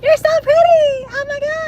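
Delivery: mock fangirl squeal, laughing; gushing fan.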